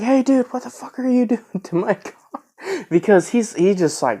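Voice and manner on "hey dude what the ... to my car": high-pitched retold yell at the guy; much higher mock outburst at the guy revving my car. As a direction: yell it up high, half laughing. Stranger revving narrator's car.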